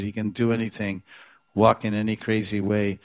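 normal talking voice

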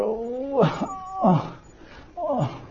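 high little oh oh oh while stretching; high effortful oh sounds; high straining grunts. Acted. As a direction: squeak with each reach. Straining to reach flower.

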